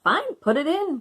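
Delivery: high, eager go-ahead; urging a friend on with glee; bright child's cry of yes. A narrator's reading. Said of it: "cried Betsy".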